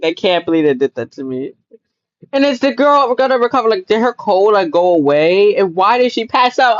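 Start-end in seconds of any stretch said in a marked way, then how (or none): none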